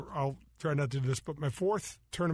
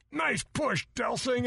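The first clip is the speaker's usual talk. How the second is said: mocking heckle; 7 semitones higher, slower than usual. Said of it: "I hear this".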